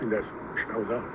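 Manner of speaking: usual speaking voice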